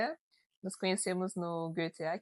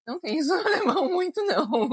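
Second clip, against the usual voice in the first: laughing; 9 semitones higher, 6 dB louder, much faster than usual. Real, not acted. Laughing mid-sentence while explaining.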